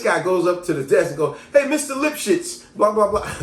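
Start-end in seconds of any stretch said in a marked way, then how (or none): 1.5-3.3 high, loud oblivious hail; calling out. Acted. Classmate innocently addresses teacher by wrong name, calling out. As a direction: call the teacher across the desk.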